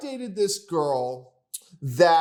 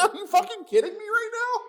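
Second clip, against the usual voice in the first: much higher, cracking up in disbelief; laughing disbelief; 13 semitones higher than usual. Real, not acted. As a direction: laugh it out, incredulous.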